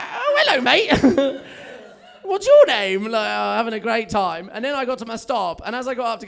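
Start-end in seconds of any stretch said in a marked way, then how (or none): 0.0-3.0 very high, loud pet-talk; special dog voice. Acted. Man chatting with a dog on the tube, special dog voice. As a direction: coo loudly at a new animal friend. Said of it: "my special voice that you use for random dogs".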